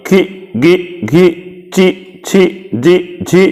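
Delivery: खी, गी, घी, ची, छी, जी, झी read one by one, slow, each with a long ii; syllable drill. Shown as a demonstration. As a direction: spell out each syllable slowly for the class. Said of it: "लिखा हुआ है क पर की".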